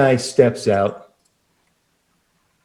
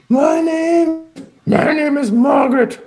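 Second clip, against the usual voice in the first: sultry high hello. Acted. Receptionist greeting the player, high and coy. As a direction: coo it like a flirty receptionist.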